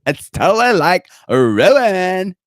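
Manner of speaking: mock Valley Girl dreaming of modeling. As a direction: ham up a ditzy Valley Girl. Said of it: "You sound like a fucking Valley Girl".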